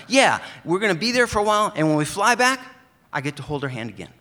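high, loud, eager friend's voice; Brian's eager voice. Retold or imitated. Brian greets his mail carrier, high and loud. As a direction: pitch it way up and call out to your old buddy. Brian continues excited news.

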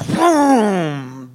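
loud, long drawn-out boom; imitated explosion boom. Teller recreating the midnight grenade blast, booming imitation. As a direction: boom it out, high and held.

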